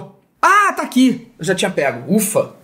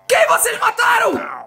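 panicked shout; 9 semitones higher, much slower than usual. Real, not acted. Gamer yelling at villagers who killed his horse, panicked shout. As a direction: yell in alarm at the raiders.

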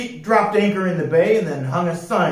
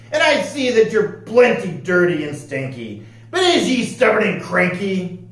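loud pirate captain, sizing up a recruit; 6 semitones higher, 5 dB louder than usual. Acted. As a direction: question him like an old sea dog. Voices pirate captain Pete.

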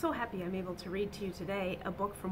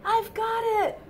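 high, loud call; 12 dB louder than usual. Acted. Pete calling to teammates for the fly ball, high shout. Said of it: "calls Pete".

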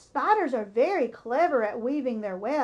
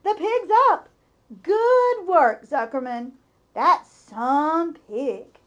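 loud, higher cheering shouts; crowd cheering; 4 semitones higher, 9 dB louder, slower than usual. A narrator's reading. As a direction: cheer the pig back on his feet. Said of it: "yelled the crowd".

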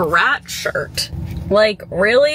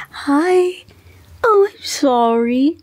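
cooing pet talk; 6 semitones higher, much slower than usual. Real, not acted. Owner to Buttercup in the closet, high slow cooing. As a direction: coo an apology to a pet you disturbed.

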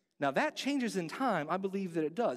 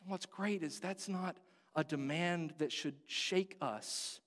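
normal talking voice

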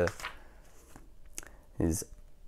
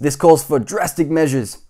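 raised, louder pronouncement; wheezing doctor; 6 semitones higher, 13 dB louder than usual. A narrator's reading. Doctor Plump deciding on treatment, wheezed pronouncement. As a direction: declare the remedy firmly. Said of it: "that he wheezed".

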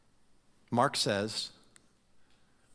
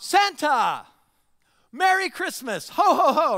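loud, much higher cheerful shout; yelling out; 10 semitones higher, 7 dB louder than usual. Retold or imitated. Shopper yelling to Santa across the mall, loud and cheery. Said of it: "you yell out".

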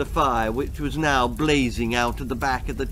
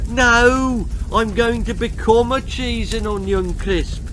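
insistent; 6 semitones higher, 5 dB louder than usual. Acted. Insists to cook.

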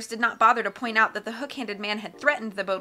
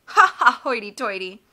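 mocking laugh; 8 dB louder than usual. A narrator's reading. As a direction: gloat mockingly.

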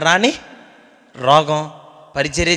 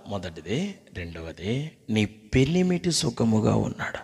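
ordinary talk, nothing marked

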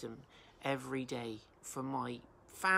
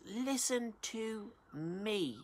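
drawn out slowly, higher, a lightly voiced pleading woman; wisdom's last plea; 8 semitones higher, much slower than usual. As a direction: stretch it out high and slow as a final call. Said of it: "She's crying out".

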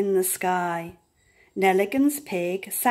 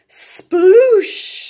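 loud breathy sploosh; whispered sploosh; 9 semitones higher, 9 dB louder than usual. A narrator's reading. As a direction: breathe out a huge splash. Big splash sound effect.

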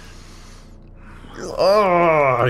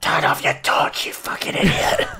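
whispered warning; 2 semitones higher than usual. Real, not acted. Sneaking in game, warning teammate.

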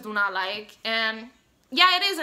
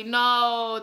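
usual speaking voice

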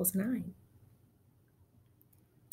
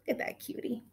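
whisper; 10 dB quieter, faster than usual. Real, not acted. Cooing at dog picture.

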